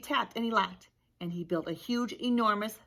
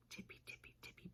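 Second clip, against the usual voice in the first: sneaky whispered tippy, tippy; hushed storybook whisper; 27 dB quieter, faster than usual. A narrator's reading. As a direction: breathe the words like creeping bunnies. Bunnies sneaking at night.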